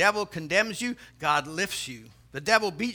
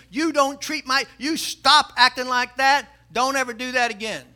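raised voice, a touch of stern anger; mock scolding; 5 semitones higher, 7 dB louder than usual. Store owner telling off the parrot in a joke, mock scolding. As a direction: tell off a misbehaving pet, playfully acted.

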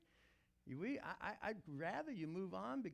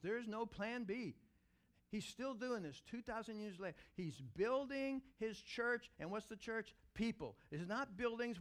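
higher and louder, building; fired-up preaching; 5 semitones higher, 3 dB louder than usual. Acted. Pastor rallying his congregation, loud and high. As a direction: preach it out with rising fervour. Sermon rising to full preaching.

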